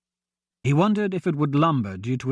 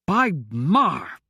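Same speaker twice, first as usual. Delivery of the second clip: frustrated exclamation; 2 semitones higher than usual. A narrator's reading. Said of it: "Royce exclaimed in frustration".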